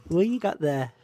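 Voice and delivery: ordinary talk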